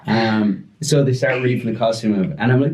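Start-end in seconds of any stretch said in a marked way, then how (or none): none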